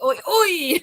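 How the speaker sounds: "Oi, oi" high with a laugh. Shown as a demonstration. S0 showing how he thought it was meant, laughing. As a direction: toss off "Oi, oi" through a chuckle. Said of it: "it was like".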